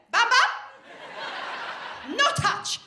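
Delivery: high, loud mock-Italian toddler voice; toddler's Italian accent. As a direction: belt it out like an Italian-sounding toddler. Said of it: "I don't know why she had that accent".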